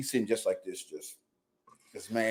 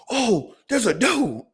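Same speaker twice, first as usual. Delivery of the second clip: much higher, slow, drawn-out mock dad; mock-surprised dad; 11 semitones higher, slower than usual. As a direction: stretch it out high like a casual dad. Dad spotting a doe.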